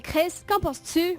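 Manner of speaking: «Chris, qu'en penses-tu?» slowed, vowels dragged in a mock Quebec accent; fake Quebec accent. Shown as a demonstration. [name] teaching the French her mock Quebec accent.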